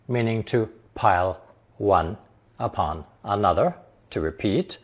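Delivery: ordinary talk, nothing marked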